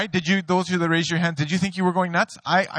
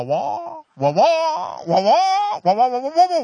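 much higher and louder wah-wah noise; mock nagging wah; 9 semitones higher, 5 dB louder, slower than usual. Husband hearing his wife as noise, high mock wah-wah. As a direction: squawk it like a cartoon adult.

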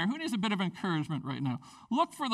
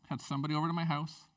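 ordinary talk, nothing marked

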